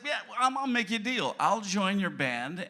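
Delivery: ordinary talk, nothing marked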